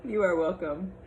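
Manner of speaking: lively picture-book read-aloud with character voices; reading to children. Acted. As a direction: read it to a class of little kids.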